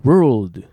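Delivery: very loud "World" with careful l; pronunciation drill. Shown as a demonstration. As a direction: punch out "World", tongue tip up before d. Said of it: "Lの音に気をつけて".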